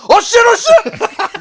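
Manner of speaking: high, loud panicked shouting; a startled cry that goes way up and gets loud; panicked yell. Retold or imitated. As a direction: yell it like you've just been grabbed in panic. Retelling panic at plane door.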